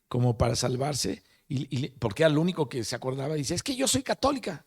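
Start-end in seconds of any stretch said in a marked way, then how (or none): 3.5-4.6 mock desperate plea, much higher; desperate cry. Doctor pleading to the angel, high desperate cry. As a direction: cry out to be saved. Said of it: "a gritarle al ángel".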